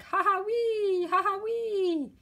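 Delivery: high "ha, ha, wee" snore noises; silly snore imitation. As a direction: squeak out a goofy snore. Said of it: "Other times it's like".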